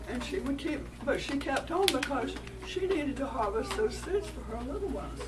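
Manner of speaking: usual speaking voice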